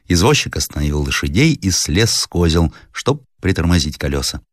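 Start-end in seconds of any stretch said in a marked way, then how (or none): none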